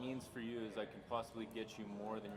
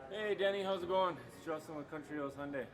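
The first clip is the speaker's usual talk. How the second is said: a much higher, louder, eager cold-call opener; upbeat sales caller; 8 semitones higher, 7 dB louder than usual. Pretend. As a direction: greet the customer like a bubbly salesman. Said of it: "let's just jump right into some role playing".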